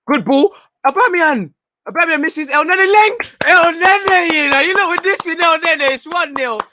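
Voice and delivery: high, loud, overjoyed goal shout; ecstatic goal call. Real, not acted. Fan calling Arsenal's opener to his viewers, ecstatic shout. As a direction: scream it like your team just scored.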